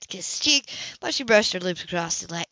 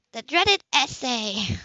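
slow, teasing mock-ominous; playful mock doom; mock dread; 4 semitones higher, much slower than usual. A narrator's reading. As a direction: drag it out like a spooky title. Anna teasing about essay.

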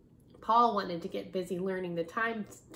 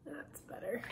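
unvoiced whispered aside; 2 semitones higher, 11 dB quieter than usual. Real, not acted. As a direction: breathe it under your breath. Aside while handling book.

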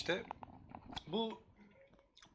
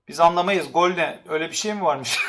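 ordinary talk

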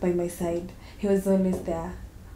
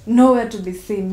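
much louder burst of frustration; exasperated; 2 semitones higher, 10 dB louder than usual. Acted. Abandoned pregnant woman on the missing father, exasperated. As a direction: throw it out in exasperation.

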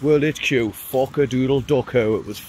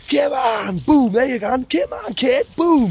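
much higher, shouted hype at fellow ravers; hype call; 9 semitones higher, slower than usual. Retold or imitated. Raver hyping people up on the dance floor with high shouts. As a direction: pump up the crowd around you.

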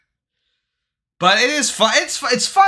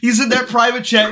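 ordinary talk

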